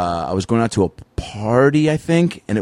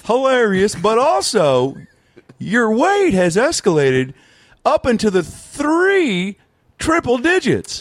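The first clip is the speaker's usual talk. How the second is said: slow, high Doctor Phil drawl; Doctor Phil impression; 9 semitones higher, slower than usual. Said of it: "if I don't do a Doctor Phil".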